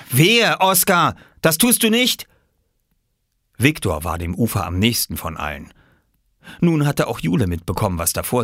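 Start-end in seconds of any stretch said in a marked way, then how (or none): 0.1-2.3 high, loud, emphatic threat; warning shout. Acted. Swimmer forbidding the boy to drop clothes, shouted warning. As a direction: warn loudly, voice jumping up.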